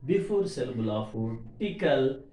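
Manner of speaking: ordinary talk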